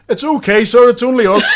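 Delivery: a masked schoolboy reassuring his teacher, cheekily; cheery kid. Retold or imitated. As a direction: lift it up, cheeky and loud.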